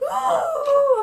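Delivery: a very high gasp that breaks into a squealing ooh; shrieking gasp. Real, not acted. Vlogger discovers another item, gasping shriek of joy.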